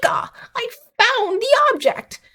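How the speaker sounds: very high, put-on squeal of triumph; hammed-up cartoon eureka moment; mock triumphant discovery. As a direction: imitate an overexcited finder in a high voice. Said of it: "this was going on in her head".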